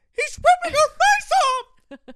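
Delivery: very high, slow, shrill mock report; high squeaky voice. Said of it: "what's funny is me saying".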